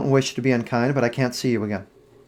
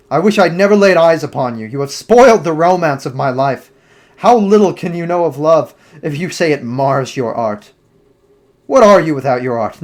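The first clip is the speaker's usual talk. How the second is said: louder, heated reproach, voice pushed up; furious lover lashing out, raised voice; angry outburst; 3 semitones higher, 8 dB louder than usual. Acted. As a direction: hurl the accusations at her. Dorian berates Sybil.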